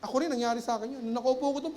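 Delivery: ordinary delivery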